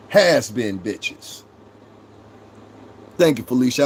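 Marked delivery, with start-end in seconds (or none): 0.1-1.4 slow contempt. Real, not acted. Repeating the insult.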